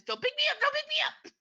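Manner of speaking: panicked squeal. Acted. Tiny character trapped in jar.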